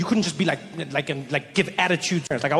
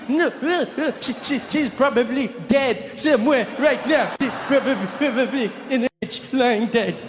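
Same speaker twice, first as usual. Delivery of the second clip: high, stammering kid in hammed-up tears; sobbing child; 8 semitones higher than usual. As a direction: play a forgotten ten-year-old, hiccuping through mock sobs. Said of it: "I felt like I was that kid, ten years old again".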